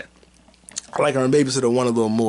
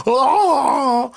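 sharp yelp; 13 semitones higher, 6 dB louder than usual. Acted. Outburst after the mockery.

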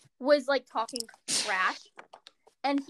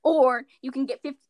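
mock ad read; 5 dB louder than usual.

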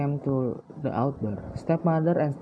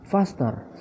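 higher-pitched, lightly voiced mock shout; 6 semitones higher than usual. A narrator's reading. Stepsister hurrying Cinderella along, a mild higher mock shout. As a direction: snap the order out, pitch jumping up. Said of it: "shouted one stepsister".

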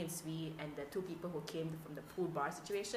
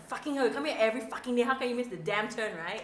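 loud, fast, higher-pitched mock fury; exasperated inner rant; 6 semitones higher, 8 dB louder than usual. Host silently berates the late client, mock furious. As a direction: rattle off an irritated cursing rant.

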